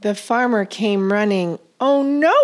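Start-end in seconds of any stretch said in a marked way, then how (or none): 1.8-2.4 higher, louder cry of alarm; alarmed shout. A narrator's reading. Farmer shouting on spotting the fox at night. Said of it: "shouted the farmer".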